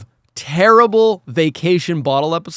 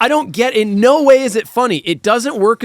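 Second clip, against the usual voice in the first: exasperated; 6 semitones higher, 4 dB louder than usual. Real, not acted. Ranting about the joke.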